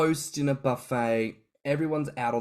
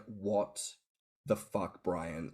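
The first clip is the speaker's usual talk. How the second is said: low, quiet what the fuck; hushed disbelief; 5 semitones lower, 8 dB quieter, much slower than usual. Retold or imitated. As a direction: mutter it in stunned disbelief. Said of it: "texting you ... being like".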